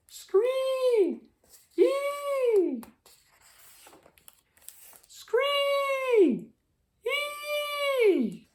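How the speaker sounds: very high drawn-out bird screech; hawk screech. A narrator's reading. The red-tailed hawk screeches overhead, very high and drawn out. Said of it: "ones in Buckthorn Forest that went".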